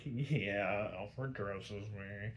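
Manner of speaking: low, slow, soft and sheepish; bashful mumble. Retold or imitated. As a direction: mumble it like a blushing rich boy caught out. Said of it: "kinda blushes".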